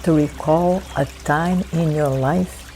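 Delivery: unhurried, letting each phrase settle before the next; guided meditation. Acted. Facilitator guiding listeners to breathe and recall God's presence, guided meditation.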